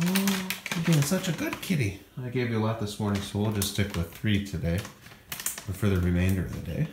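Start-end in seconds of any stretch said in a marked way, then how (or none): 0.1-2.0 slow high cooing to a pet; cooing praise. Real, not acted. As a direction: draw it out sweetly for the kitty.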